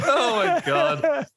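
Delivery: high and slow through laughter; laughing oh my God. Real, not acted. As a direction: laugh it out in disbelief. Laughing at the plug.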